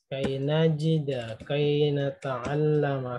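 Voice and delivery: Nasila, najida, nata'allama said slowly, final -a stretched; teacher drilling endings slowly; slow clear ending. Shown as a demonstration. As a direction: drill each verb slowly, showing the fathah ending. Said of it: "Semua ini berakhiran fathah".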